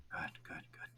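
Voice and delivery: whispered under the breath; barely audible relief. Real, not acted. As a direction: mutter it to yourself. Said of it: "GM mutters to himself".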